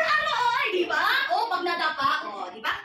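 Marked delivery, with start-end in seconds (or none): 0.0-0.7 high-pitched mock ouch; sample yelp after a fall; demonstrated pain cry. Shown as a demonstration. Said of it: "Sumisigaw kayo".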